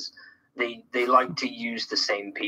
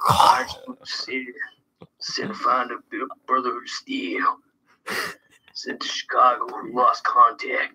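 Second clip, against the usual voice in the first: Rick from Walking Dead. Acted. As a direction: whisper it haltingly, like a weary survivor. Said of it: "sounding like Rick from Walking Dead".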